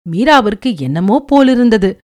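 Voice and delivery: plain reading voice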